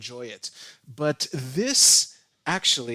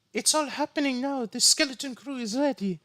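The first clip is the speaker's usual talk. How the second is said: reading it high and theatrical; high dramatic voice; 12 semitones higher than usual. Pretend. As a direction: lift the pitch way up, ominous. Reading villain lines, high pitched.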